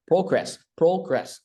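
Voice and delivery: "progress" with a high first syllable, falling on gress. Shown as a demonstration. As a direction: pitch PRO up in "progress", drop the second half. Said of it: "có âm lên âm xuống".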